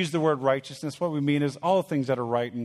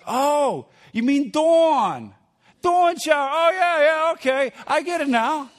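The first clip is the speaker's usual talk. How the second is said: high, loud Long Island cousin voice with a laugh; hammed-up Long Island cousin, much higher and louder; Long Island accent; 12 semitones higher, 6 dB louder than usual. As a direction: mimic the Long Island relative catching on. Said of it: "they just talk differently".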